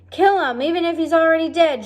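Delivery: high, loud, fast shouted rule. Acted. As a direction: rattle off the rule in a yell. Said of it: "shouted Cook".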